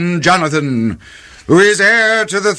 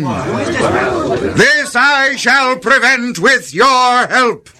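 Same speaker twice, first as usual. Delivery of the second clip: raised-pitch royal decree; imperious command; 4 semitones higher than usual. Acted. King orders his court to stop a plotter, commanding. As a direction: order the whole court with weighty authority.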